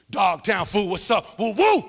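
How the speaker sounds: loud gang call, rising whoops. Acted. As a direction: holler the barrio challenge, then whoop high. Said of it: "Dog Town dudes walk around the neighborhood intimidating".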